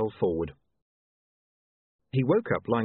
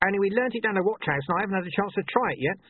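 high-pitched, chatty watchman; eager little watchman voice; Corporal Nobbs; 10 semitones higher than usual. A narrator's reading. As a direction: natter on helpfully in a high character voice.

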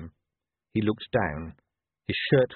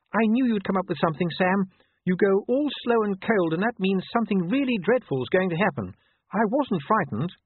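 much higher-pitched lady's voice; devoted wife, trusting; 11 semitones higher than usual. Acted. Wife reassures her husband after the danger, high-voiced.

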